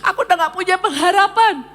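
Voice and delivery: retelling a crushed, weeping plea; tearful despair. Retold or imitated. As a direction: sob out the despair. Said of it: "Saya nangis, saya bilang".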